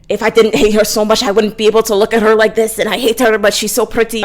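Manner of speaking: loud, badly faked Irish accent. Reviewer mimicking hero who hates the heroine, bad Irish accent. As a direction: rattle it off in a poor Irish brogue. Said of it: "so sorry about my Irish accent".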